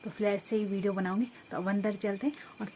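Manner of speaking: soft conspiratorial hush. Real, not acted. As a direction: talk low beside a sleeping man. Papa asleep, must not wake.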